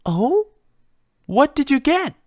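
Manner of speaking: a timid pony fretting over a broken vase; Fluttershy's voice. Acted.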